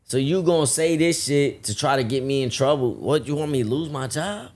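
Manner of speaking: high, heated scolding; indignant rant. Retold or imitated. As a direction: tell him off, outraged. Retold rant at the customer.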